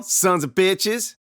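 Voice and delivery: drawn-out, loud defiant taunt; slow taunt. Acted. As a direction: spit it out slowly at them. Kid taunting brothers.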